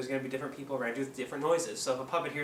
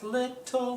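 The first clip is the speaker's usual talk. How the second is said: "Little" sung high in two clear syllables; high two-beat "Little"; syllables marked; 11 semitones higher than usual. Shown as a demonstration. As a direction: split "Little" into its two beats. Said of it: "So on little, I open it twice".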